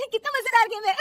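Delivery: high, loud giggly delight; laughing hard. Acted. Daughter-in-law back at the TV serial, laughing. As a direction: laugh through the words. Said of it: "जोर जोर से हंस रही होती है".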